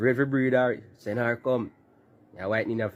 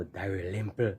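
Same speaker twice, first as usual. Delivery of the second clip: low, soft "Dalrymple" in dismay; dismayed repeat; 5 semitones lower, 6 dB quieter, slower than usual. Real, not acted. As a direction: drop "Dalrymple" low with disappointment. Repeats name after "oh no.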